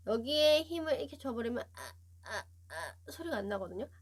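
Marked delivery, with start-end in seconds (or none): 1.6-3.1 squeezed, choked "ah" from a tight throat; strangled voice. Shown as a demonstration. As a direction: clamp the throat and push out a pinched "ah". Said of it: "이렇게 쪼여버리면 소리가 안 나요".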